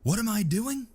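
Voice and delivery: high furious yell; angry. A narrator's reading. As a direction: chew the trooper out. Sarge's enraged chewing out.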